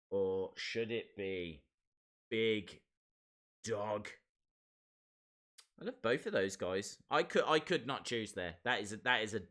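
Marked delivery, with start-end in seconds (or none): 0.1-4.1 slow dramatic reveal. Acted. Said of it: "host poll drama".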